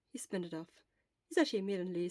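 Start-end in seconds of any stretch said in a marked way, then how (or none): none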